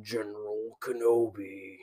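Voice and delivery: slow, drawn-out Star Wars villain voice; villain character impression. Friend answering the Obi-Wan greeting, slow villain character impression. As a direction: draw out the name like General Grievous. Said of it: "So good".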